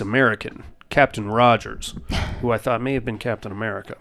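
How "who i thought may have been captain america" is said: usual speaking voice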